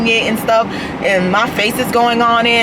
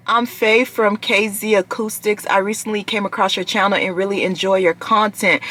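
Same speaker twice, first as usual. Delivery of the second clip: ordinary talk, nothing marked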